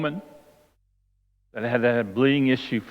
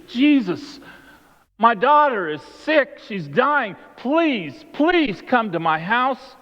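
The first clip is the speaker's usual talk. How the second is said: high-pitched, urgent begging, acted out; desperate pleading; 7 semitones higher, slower than usual. Synagogue leader begs Jesus for his daughter, pleading. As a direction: plead as a father for a dying child.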